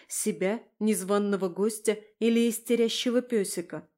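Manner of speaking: plain reading voice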